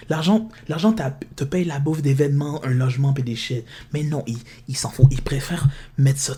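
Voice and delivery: ordinary talk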